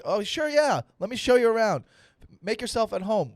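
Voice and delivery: high-pitched sarcastic host; mock welcome. Pretend host to a random dog, mock welcome. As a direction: gush a fake, over-eager welcome, high and loud.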